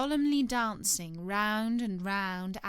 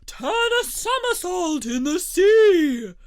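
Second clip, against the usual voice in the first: high, loud, excited shout; capering Mock Turtle at full pitch; wild cry; 11 semitones higher, 11 dB louder than usual. A narrator's reading. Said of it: "cried the Mock Turtle, capering wildly about".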